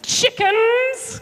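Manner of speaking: high, loud taunting bellow; Goliath bellowing. Acted. Goliath mocking the soldiers, loud high bellow. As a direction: jeer it at the cowards. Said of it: "Goliath bellowed".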